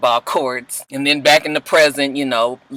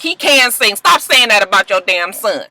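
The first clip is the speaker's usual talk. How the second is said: high, sharp clapback; mock Cookie snapping; 6 semitones higher, 4 dB louder than usual. Cookie defending her son to Lucius, snapping. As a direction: snap back at him.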